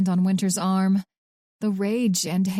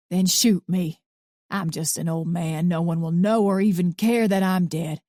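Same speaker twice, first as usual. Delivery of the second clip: put-on gruff old man. A narrator's reading. Arthur's voice.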